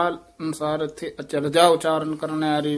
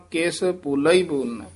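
normal talking voice

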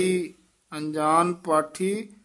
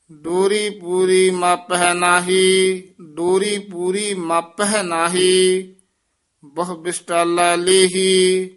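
slow, raised reading of the verse; Gurbani recitation; 3 semitones higher, 7 dB louder, much slower than usual. Acted. Teacher reciting the shabad for the student. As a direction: chant the hymn out solemnly.

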